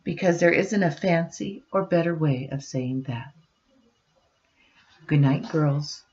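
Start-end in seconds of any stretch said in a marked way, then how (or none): 5.1-6.0 softer, higher loving murmur; tender goodnight. Real, not acted. Parent saying goodnight to daughters, soft tender voice.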